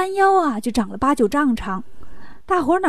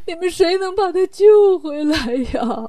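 lightly voiced tearful king's plea; a touch of crying in a king's appeal; pleading king; 4 semitones higher, 3 dB louder than usual. King begs his officials to rescue his child, pleading.

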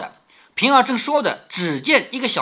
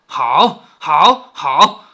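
seething approval; 3 semitones lower, 7 dB louder, much slower than usual. Acted. Mistress seethes at deceitful servant.

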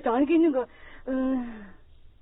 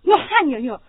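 ordinary talk, nothing marked

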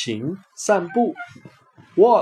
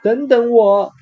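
shouting loudly; 7 semitones higher, 4 dB louder than usual. Acted. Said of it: "艾博尔大声叫道".

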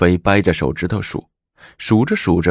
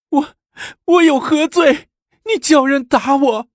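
very high, loud wail; tearful screaming; 4 dB louder than usual. A narrator's reading. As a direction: cry out in wronged protest. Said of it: "披头散发的哭叫着". (哭叫着喊冤)

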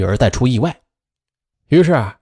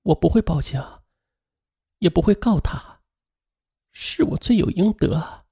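in pain; 7 semitones higher, 5 dB quieter than usual. A narrator's reading. Injured mother in hospital, soft and anguished. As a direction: confess with broken regret. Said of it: "他痛苦地说".

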